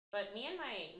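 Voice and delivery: normal talking voice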